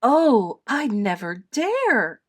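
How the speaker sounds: mildly worried, drawn out; a touch of worry, slowly. A narrator's reading. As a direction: fret lightly over a dare. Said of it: "Edith worried".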